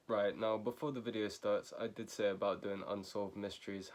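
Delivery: ordinary delivery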